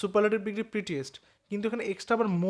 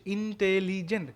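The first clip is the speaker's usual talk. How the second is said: "Intelligent" in four measured beats, ইন, টে, লি, gent; syllable by syllable; slower than usual. Shown as a demonstration. Teacher showing four stops in "intelligent", said slowly syllable by syllable. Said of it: "চারবার থামতে হচ্ছে".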